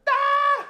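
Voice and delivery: shrill cackling yelp, way up high; high mocking screech; mocking high shriek. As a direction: let out a taunting squeal. Mocking Giants fans.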